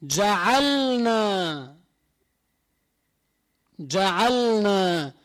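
جعلنا very slow, the lam sounded before نا; clear lam in ja'alna. Shown as a demonstration. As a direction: stretch جعلنا and keep the lam distinct. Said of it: "أن يظهر اللام". (إظهار اللام في جعلنا ببطء)

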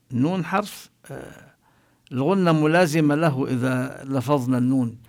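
ordinary talk

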